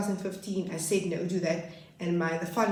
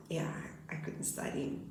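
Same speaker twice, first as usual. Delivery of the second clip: softer sad whisper; 2 semitones lower, 9 dB quieter than usual. Real, not acted. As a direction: barely breathe the words out. Sad recollection of rejection.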